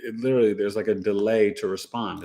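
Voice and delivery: usual speaking voice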